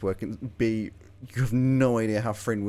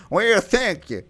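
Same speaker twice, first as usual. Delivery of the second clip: put-on Irish accent; 10 semitones higher, 7 dB louder, slower than usual. Irish accent bit continues.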